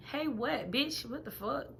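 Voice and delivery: high, incredulous, sassy outburst; incredulous clapback. Retold or imitated. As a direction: act shocked he dared to text. Reacting to his hey message.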